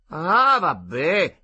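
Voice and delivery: impatient yell from the other room; shouted back. A narrator's reading. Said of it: "gridò quella".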